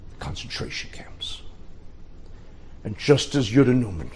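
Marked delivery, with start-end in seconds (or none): 0.1-1.4 hushed whisper. A narrator's reading. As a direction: whisper it, voiceless. Dark turn in the story.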